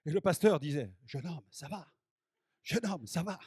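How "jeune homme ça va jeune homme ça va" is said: very soft, as if from far away; barely audible, gently checking on someone; distant voice. Retold or imitated. Pastor heard faintly by a man in a trance, very soft distant voice. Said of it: "J'entends une voix au loin".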